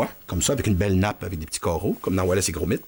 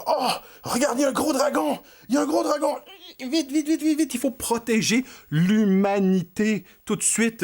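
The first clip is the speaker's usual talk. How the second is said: high, urgent panicked cry; excited alarm; 10 semitones higher than usual. Acted. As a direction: sound the alarm high and fast. Heroes spot giant dragon.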